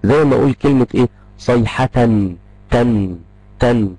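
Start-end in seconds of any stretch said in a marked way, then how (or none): 1.4-3.9 صيحة ending in a clearly sounded تن; teacher isolating the final n sound in تن; audible tanween n. Shown as a demonstration. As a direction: let the n of تن ring out, repeated on its own. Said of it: "في صوت نون".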